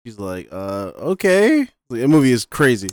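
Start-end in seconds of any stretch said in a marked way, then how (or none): 0.5-1.7 mock bewildered okay. Mock Cindy reaction.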